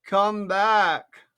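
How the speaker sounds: loud call after someone leaving. A narrator's reading. Caterpillar calling Alice back as she leaves, loud. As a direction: call her back across the room. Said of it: "the caterpillar called after".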